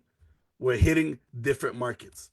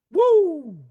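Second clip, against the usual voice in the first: loud, high whoop of excitement; excited whoop; 13 semitones higher, 6 dB louder than usual. Real, not acted. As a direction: cheer like you're hyping the room. Hyping up the audience.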